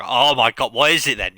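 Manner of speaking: breathless with curiosity; excited. Retold or imitated. Eager audience reaction quoted.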